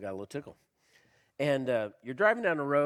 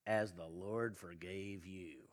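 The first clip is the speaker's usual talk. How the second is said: solemn emphasis; 7 semitones lower, 10 dB quieter, slower than usual. Acted. Scripture punchline said slowly.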